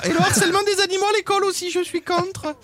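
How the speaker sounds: high, breathy mock beauty contestant; pageant contestant voice. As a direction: parody a Mister France winner's speech. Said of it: "Et il a parlé des animaux".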